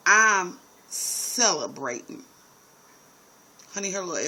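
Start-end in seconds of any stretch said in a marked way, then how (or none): 0.1-2.2 put-on drunk Southern. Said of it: "She said".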